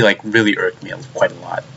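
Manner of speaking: ordinary talk, nothing marked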